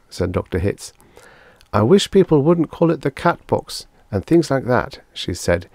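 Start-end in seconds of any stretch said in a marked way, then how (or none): 1.7-5.0 high, louder woman's complaint; receptionist character. A narrator's reading. Receptionist objects to doctor's slang, high-voiced. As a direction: object in a raised, pained pitch.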